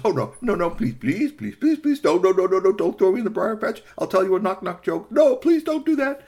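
high, loud stream of pleas; frantic begging. Acted. Rabbit begging not to be thrown, hammed-up panic. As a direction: beg desperately at full pitch.

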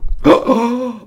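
high, loud sharp gasp; shocked gasp. Acted. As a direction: gasp in alarm. Witch sees the crowd.

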